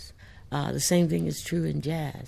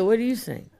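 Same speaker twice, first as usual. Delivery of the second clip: higher, louder, playful question back; teasing reply; 7 semitones higher, 5 dB louder than usual. Retold or imitated. Author retelling her teasing answer to readers, higher and louder. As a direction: toss the question back with a grin. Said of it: "And I would say".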